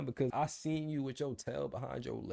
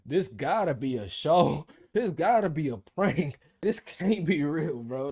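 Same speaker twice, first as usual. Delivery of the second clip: louder, higher laughing disbelief; 5 semitones higher, 8 dB louder than usual. Real, not acted. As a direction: laugh at how absurd it is. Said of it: "That's how I felt".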